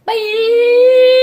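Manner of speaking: very loud, high, drawn-out bye; shouted goodbye. Real, not acted. Child signing off mom's vlog, shouted goodbye. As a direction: yell it out long and high.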